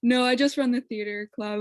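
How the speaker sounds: normal talking voice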